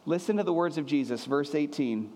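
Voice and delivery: usual speaking voice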